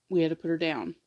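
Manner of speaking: normal talking voice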